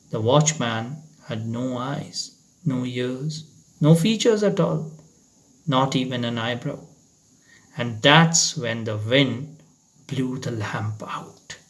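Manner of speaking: hushed eerie narration. A narrator's reading. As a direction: let it fade into dread. Hushed final reveal.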